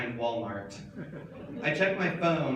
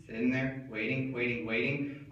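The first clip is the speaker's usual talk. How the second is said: ordinary talk, nothing marked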